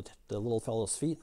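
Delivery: ordinary talk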